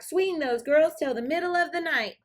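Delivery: high, loud dance call; called back. Acted. Joe calls back to Cindy mid-dance, high and loud. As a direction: call it back over the music. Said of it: "Joe called back".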